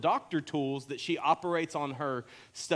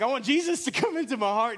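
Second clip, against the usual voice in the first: put-on higher-pitched kid voice; imitated little boy; 6 semitones higher, 3 dB louder than usual. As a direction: mimic a small boy's earnest request. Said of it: "he's like".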